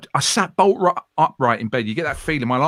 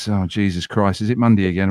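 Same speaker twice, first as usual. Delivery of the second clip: lower, weary just-out-of-bed groan; groggy grumble; 7 semitones lower than usual. Shown as a demonstration. Presenter shows a tired Monday morning on air, lower weary grumble. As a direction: sound like you've just rolled out of bed. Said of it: "it's just an inflection".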